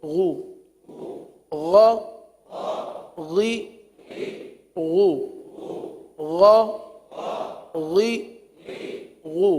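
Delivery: slow, measured recitation for pupils to repeat; drawn-out spelling drill. Shown as a demonstration. Teacher leading pupils through Arabic letters, slow chanted drill. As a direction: chant each letter name long and level, then the short syllable.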